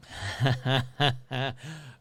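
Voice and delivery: laugh. Real, not acted. Host cracks up over a listener's comment, laughing. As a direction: burst of chuckling.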